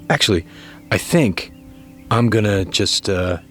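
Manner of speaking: usual speaking voice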